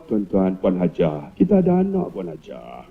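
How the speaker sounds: slow, soft and low; calm her with a gentle murmur; soothing. Acted. Teachers placate the nagging principal, slow soft low voice.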